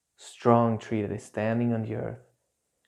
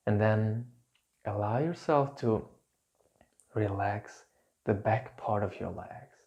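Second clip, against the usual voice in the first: calm grounding exercise. Acted. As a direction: gently guide them to let tension drain. Leads a grounding meditation.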